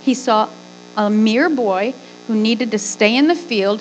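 ordinary talk

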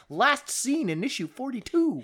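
high, announcer-like caption read; comic book caption voice. Retold or imitated. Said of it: "comic book where it appears".

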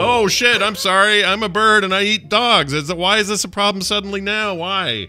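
high, loud flustered hawk; comic high-pitched bird voice; protesting bird. Acted. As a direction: plead your case as a caught bird. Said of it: "and the bird went".

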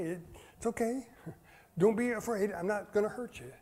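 soft, gentle coaxing voice; soothing. Acted. Pastor calming a frightened little child, softly soothing. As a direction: hush a scared child, softly.

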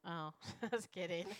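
usual speaking voice

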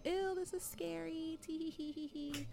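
high, soft, girlish mock-spooked titter; mock scared giggle. Friends creeping around at night, high mock-scared giggle. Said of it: "we're like".

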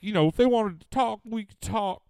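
bad high-pitched rapper impression; squeaky put-on rapper voice; [name] impression. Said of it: "That's a bad F B G Duck impression".

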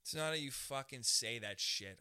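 loud, emphatic statement; forceful delivery. Shown as a demonstration. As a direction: say it out loud and hard. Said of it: "How you say shit".

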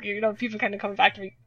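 ordinary talk, nothing marked

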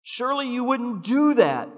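loud, high exclamation; exclaimed pleading. Shown as a demonstration. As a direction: cry it out as an exclamation. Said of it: "Exclamation point".

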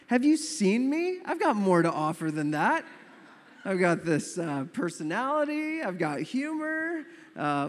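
high, loud protest, slowing on the boasts; comically affronted self-promotion; mock indignation.

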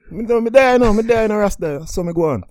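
loud, high Jamaican Rasta voice; hammed-up Jamaican patois; Jamaican accent. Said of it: "sorry for my poor Jamaican accent".

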